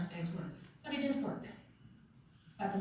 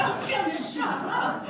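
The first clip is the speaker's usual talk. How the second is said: laughing self-scold; 11 semitones higher, 4 dB louder, slower than usual. Retold or imitated. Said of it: "I'm like".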